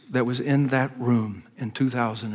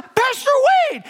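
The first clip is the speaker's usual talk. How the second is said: retold shout; 5 dB louder than usual. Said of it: "I heard someone from within that group shout".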